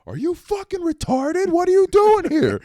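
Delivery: high, loud furious shout at a driver; angry worker yell. Retold or imitated. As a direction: yell it like an enraged road worker. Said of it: "and goes".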